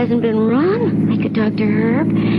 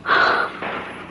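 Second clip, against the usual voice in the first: frightened gasp; 5 dB quieter than usual. Acted. She is scared by Mort.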